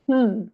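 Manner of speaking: short single hum, falling; brief closed-mouth hum; one-syllable hum. Shown as a demonstration. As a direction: hum one beat as a demonstration. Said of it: "can hum one syllable".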